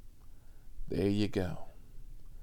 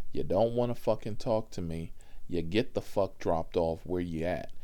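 ordinary delivery

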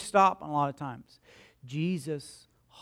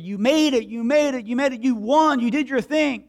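higher, louder victory cheer; cheering crowd; 6 semitones higher, 5 dB louder than usual. Retold or imitated. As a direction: cheer the winners home.

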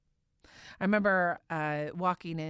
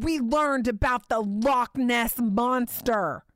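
put-on slow, higher, louder excited kid voice; animated child; 6 semitones higher, 6 dB louder, slower than usual. Mother voicing her excited daughter, higher, louder and slow. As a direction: lock pronounced loud and bright, spelled out like an excited child. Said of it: "super animated".

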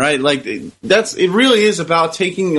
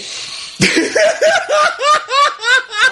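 high laugh. Real, not acted. Surprise laugh at impression.

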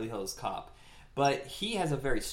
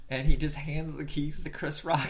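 ordinary talk

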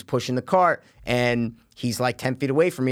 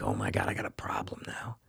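panicked whisper, much softer; 12 dB quieter than usual. Retold or imitated. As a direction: breathe it out in a hushed panic.

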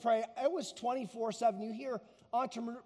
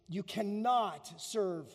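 "cannot" drawn out slowly, with full weight; slow right down to stress CANNOT; emphatic; slower than usual. Retold or imitated. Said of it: "then the emphatic".

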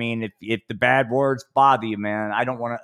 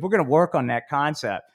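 normal talking voice

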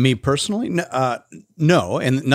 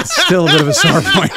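laughing; 4 dB louder than usual. Real, not acted. Joking about missing the wedding.

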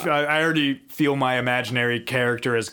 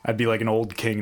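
usual speaking voice

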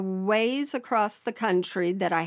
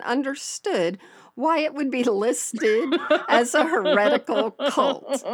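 laughing; 4 semitones higher than usual. Real, not acted. Laughing at the cult listing.

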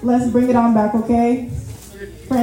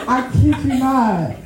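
laughing through the words, high and slow; real giggling, higher and drawn out; laughing at herself; 4 semitones higher, slower than usual. Real, not acted. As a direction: crack up at your own story. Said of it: "I'm laughing at myself".

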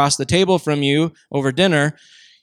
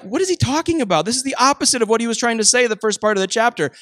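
baffled reader; 6 semitones higher, faster than usual. Retold or imitated. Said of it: "And you're like".

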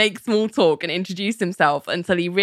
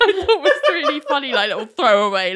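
higher, laughing while talking; 8 semitones higher than usual. Real, not acted. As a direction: let the laugh spill into it. Host laughing at the joke.

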